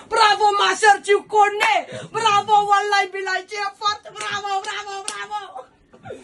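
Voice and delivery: high, loud, cheering shouts; fired-up Malian. Retold or imitated. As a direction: cheer her on wildly. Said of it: "il a commencé à s'enflammer".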